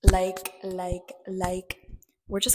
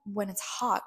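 ordinary talk

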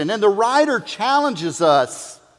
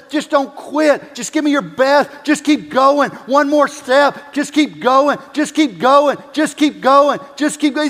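building, raised coach's cheer, a touch higher; coach urging on; 6 semitones higher, 3 dB louder than usual. Retold or imitated. Coach urging a blindfolded player across the field, raised and building. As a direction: urge him on step by step. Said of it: "the coach says".

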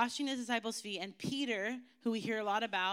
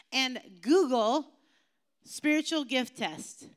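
much louder, slow Google; playful emphasis; 3 semitones higher, 7 dB louder, slower than usual. Shown as a demonstration. Pastor telling kids what to search, playful emphasis. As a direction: spell out the search.

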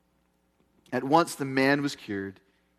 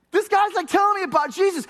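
much higher and louder, echoing her excited call; excited retelling; 14 semitones higher, 5 dB louder, faster than usual. Retold or imitated. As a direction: repeat her thrilled shout with delight. Pastor echoes the lady's exclamation.

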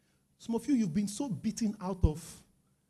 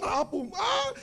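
startled father; 10 semitones higher, much slower than usual. Retold or imitated. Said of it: "His father was like".

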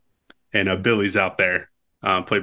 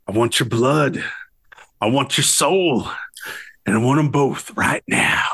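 put-on mildly raised, slurring challenge as a drunk gunfighter; drunken mock yell; 4 semitones higher than usual. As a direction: imitate a drunk calling someone out in the street. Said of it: "yelling at him".

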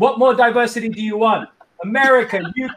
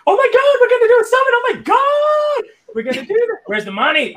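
squeaky, very high, loud panicked imitation; imitating shock; 3 dB louder than usual. Imitating Prash's panicked reaction to the summit, high squeal. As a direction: mimic a friend's shocked squeal, slowing down. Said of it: "when I felt the shock and I was like".

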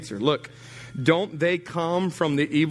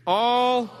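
leading the call; 7 semitones higher than usual. Shown as a demonstration. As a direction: call it out for the congregation to repeat. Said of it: "One, two, three".

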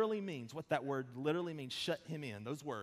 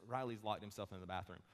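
low, soft, even delivery; retold calm report; 7 semitones lower, 7 dB quieter than usual. Wife phoning husband about locked-in son, retold calmly. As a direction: report it calmly, without alarm. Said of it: "I said it really calmly".

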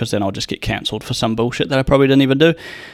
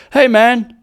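bold, much higher and louder hey man; loud self-assured hello; confident greeting; 11 semitones higher, 6 dB louder than usual. Retold or imitated. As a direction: call out with swagger. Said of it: "they don't have confidence to start with to be like".

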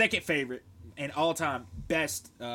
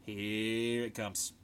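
mock dread; 2 semitones lower, much slower than usual. Retold dread on being caught.

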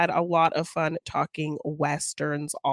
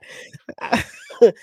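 breathy laugh; 5 semitones higher, 4 dB louder than usual. Real, not acted.

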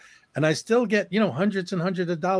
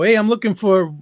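loud, higher-pitched retold call into a room; 6 semitones higher, 7 dB louder than usual. Calls into a roomful of strangers.

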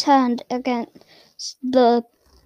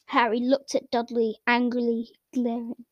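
ordinary delivery, nothing marked

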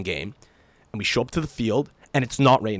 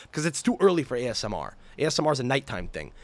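ordinary talk

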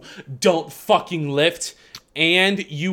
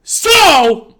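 furious rant; 5 semitones higher than usual. Real, not acted. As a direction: let the fury build and spit it out. Gym rant against old man.

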